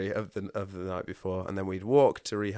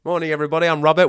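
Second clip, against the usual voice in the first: loud, higher booming intro; loud hearty greeting; 7 semitones higher, 12 dB louder, much faster than usual. Retold or imitated. Robert introducing himself to the group, loud and hearty. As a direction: announce yourself to the whole room. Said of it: "it's like".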